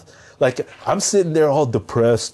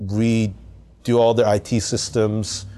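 ordinary talk

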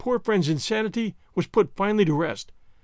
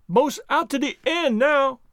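slow, higher dialect call; Jupiter calling down; 7 semitones higher, slower than usual. Acted. Servant far out on limb to master, slow high call. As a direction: report progress from high up, drawn out.

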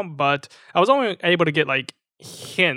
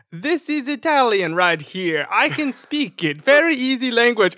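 hammed-up, higher-pitched Italian accent; mock Italian accent; 8 semitones higher, 4 dB louder than usual. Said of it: "you can do an Italian accent".

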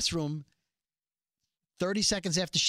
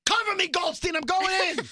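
higher, louder cop shout; action hero shout; 12 semitones higher, 5 dB louder than usual. Acted. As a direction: yell it like a TV cop. Acted TV cop scene.

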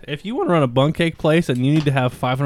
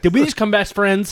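higher, louder thrilled question; excited; 8 semitones higher, 7 dB louder than usual. Real, not acted. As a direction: burst with delight. Excited about neighbor.

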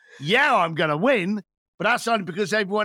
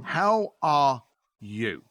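slow, lower and gentle; sincere question; 6 semitones lower, much slower than usual. Retold or imitated. Asking a friend again after the small talk, slow and sincere. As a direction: ask it like you truly want the answer. The real question, asked slowly.

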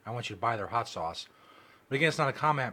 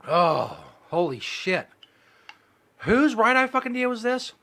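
pained yell; 9 semitones higher, 8 dB louder, slower than usual. Real, not acted. Burning mouth, pained outcry.

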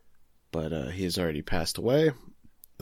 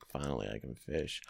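muted relief; 9 semitones lower, 12 dB quieter than usual. Retold or imitated. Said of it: "he's like".

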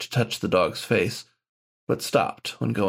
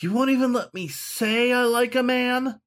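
high, frustrated shout over the sofa; shouting back. A narrator's reading. Dog-man shouting at the ghost behind the sofa. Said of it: "shouted over the back of the sofa".

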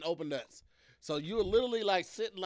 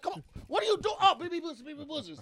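much higher, exasperated cry; mock outrage then realization; 9 semitones higher than usual. Caught saying a B word.

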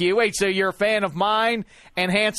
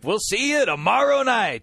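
imitating Joe Buck's call; 5 semitones higher than usual. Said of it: "just like his old man did".

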